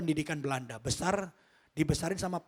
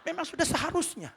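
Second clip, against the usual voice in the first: much higher put-on voice; high mimicked retort; 12 semitones higher than usual. Father withholding praise from achieving child, high-pitched mimicry. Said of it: "katanya gitu, saudara".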